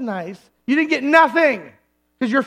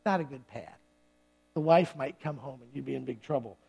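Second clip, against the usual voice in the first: much lower and softer, a dry aside; low-key warning; 11 semitones lower, 12 dB quieter than usual. Acted. Pastor paraphrasing "sin no more", low-key warning. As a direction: drop it down to a quiet word of advice. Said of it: "[name] version".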